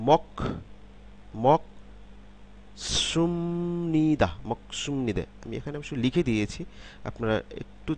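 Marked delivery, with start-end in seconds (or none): 0.1-4.3 মক, a pause, then a drawn-out সুমনিদা; মক cut short, সুমনিদা higher and long; tensed s demonstration. Shown as a demonstration. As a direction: stretch সুমনিদা slowly with a tense s and held m. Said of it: "স পরিবর্তিত হয়ে কি সাংসিয়ত হলো".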